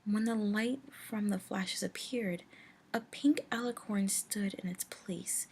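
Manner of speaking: ordinary delivery, nothing marked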